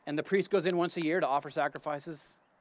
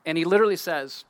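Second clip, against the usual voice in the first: ordinary talk, nothing marked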